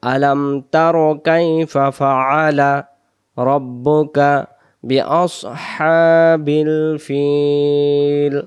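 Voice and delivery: slow, drawn-out Quranic recitation. Shown as a demonstration. Teacher reciting the verse for students, slow chant. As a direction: chant each syllable long and measured.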